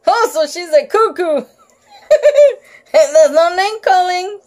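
laughing through the words; giggling. Real, not acted. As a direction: giggle at the joke.

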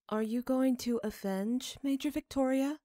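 put-on high, innocent child voice; child Sophie's voice. A narrator's reading. Sophie asks father innocent question.

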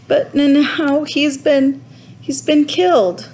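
high, halting tearful voice; grief-struck boy, slow start, pitched high; sobbing. A narrator's reading. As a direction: stammer out terrible news through acted sobs. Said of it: "sobbed Roderick".

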